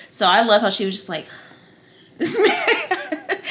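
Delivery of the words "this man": high, breaking into laughter; laughing quote. Retold or imitated. Host quoting the queen's insult, laughing too hard. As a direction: crack up while quoting someone.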